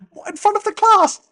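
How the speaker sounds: high, loud incredulous squeal; dismayed pupil. Retold or imitated. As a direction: yelp it like a horrified schoolkid. Dismayed pupil in acted classroom exchange.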